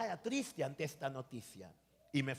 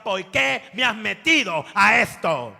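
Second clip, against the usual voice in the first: loud, slow, raised plea; retold crying complaint; 5 semitones higher, 12 dB louder, slower than usual. Pastor retells asking God why each morning, loud anguished complaint. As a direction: cry out to God, dragging each phrase. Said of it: "lloraba y le decía".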